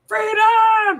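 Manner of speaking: high, loud, drawn-out cry; re-enacted cry of liberation; triumphant shout. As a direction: holler it long and high. Retells own triumphant cry to husband.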